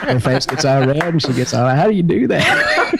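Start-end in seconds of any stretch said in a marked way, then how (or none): 1.7-2.9 higher, slow giggly question; flustered delight. Retold or imitated. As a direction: imitate a smitten, tickled wife. Imitates flustered, giggly wife.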